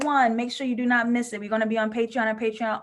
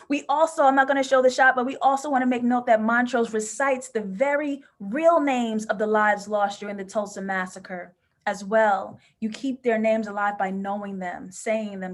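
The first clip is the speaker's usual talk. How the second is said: normal talking voice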